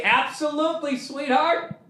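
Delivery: put-on high, soft, sugary agreeable boyfriend; sweet mock agreement. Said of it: "I would say".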